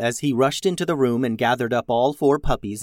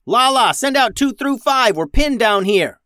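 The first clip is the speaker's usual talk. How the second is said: loud, high urgent call over the comms; yelling into comms; 10 semitones higher, 5 dB louder than usual. A narrator's reading. Max yells to Lala over comms, loud and urgent. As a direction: shout the order, pinned down. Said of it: "Max yelled into her comms".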